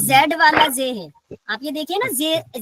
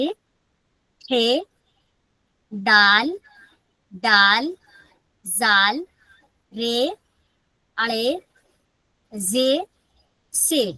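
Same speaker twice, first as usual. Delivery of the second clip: reciting the alphabet; 3 semitones lower, much slower than usual. Acted.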